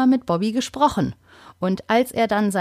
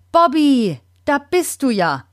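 relieved call; 5 semitones higher than usual. Acted. Grandma finds her budgie in the tree, relieved call. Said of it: "ruft sie".

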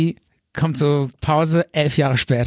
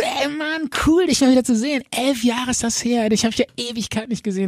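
put-on high, eager voice of an old pal; old friend bursting in at the door; excited buddy greeting; 8 semitones higher than usual. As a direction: greet him like a long-lost mate.

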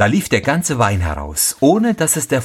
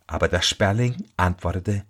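usual speaking voice

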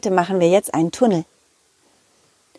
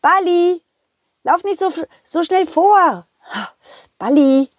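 high calling after the ball; 8 semitones higher than usual. Acted. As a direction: call the ball back, slowing at the end.

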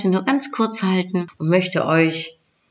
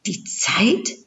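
higher, questioning rise; astonished; 4 semitones higher than usual. A narrator's reading. Love questions Knowledge's answer, astonished. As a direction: echo it back in mild disbelief. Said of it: "fragte die Liebe erstaunt".

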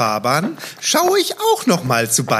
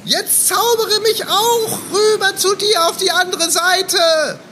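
excited; 12 semitones higher, slower than usual. Acted. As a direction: burst out in wonder, high. Kasper amazed at the magic.

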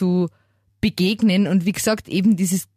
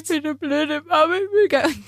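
retold crying; 7 semitones higher than usual. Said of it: "da hab ich geweint".

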